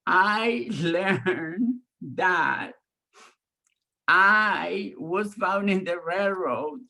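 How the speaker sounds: put-on high, slow, weepy child confession; child confessing to grandma through tears; tearful confession. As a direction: wail it out between sobs.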